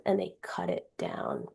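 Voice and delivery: drops to a soft, breathy whisper; hushed whisper. Real, not acted. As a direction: whisper it as if it still hurts. Tree cut down, grief.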